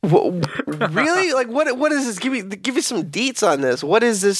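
high-pitched, incredulous demand for details; shocked disbelief. Pretend. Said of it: "he's really going into shock".

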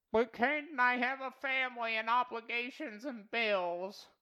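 high, slow mocking whine; mock whiny objector. Mocking a listener who pleads family and bills, high and whiny. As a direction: whine it like a complainer making excuses.

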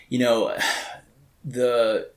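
ordinary talk

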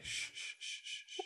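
soft, breath-only hushing; repeated soothing shh; calming shush. Acted. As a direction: shush gently to calm someone panicking. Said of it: "calm him down".

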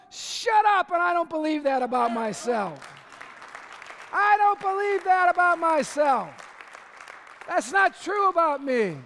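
loud defiant declaration; defiant shout. Acted. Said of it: "defiant retort to devil".

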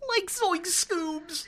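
high, slow cracking voice; Shaggy voice. Retold or imitated. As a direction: whine it like a scared stoner. Imitating Shaggy.

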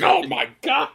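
high, loud mock dismay; exasperated outburst. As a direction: imitate someone appalled, voice shooting up. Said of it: "he asks for an explanation every time".

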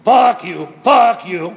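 loud angry fuck you, fuck you. Retold or imitated. How the truck driver hears the honk, loud and angry. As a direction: bark it like an insult. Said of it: "what he hears is".

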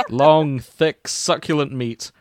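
ordinary delivery

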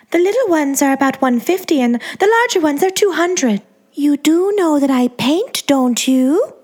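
put-on high, sweet baby-doll voice; Angeline's girly voice. A narrator's reading. To the narrator, a high, sweet starlet voice.

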